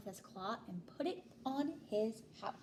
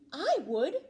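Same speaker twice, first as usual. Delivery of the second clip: louder eager reply; 4 semitones higher, 12 dB louder than usual. A narrator's reading. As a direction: call out happily as a snail. Snail answers eagerly.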